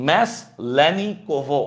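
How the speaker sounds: ম্যাসলেনিকোভো said syllable by syllable, very slowly; teacher modelling ম্যাসলেনিকোভো at a crawl; slow pronunciation. Shown as a demonstration. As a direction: spell out ম্যাসলেনিকোভো slowly, each syllable clear. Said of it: "আমার সাথে সাথে উচ্চারণ করো".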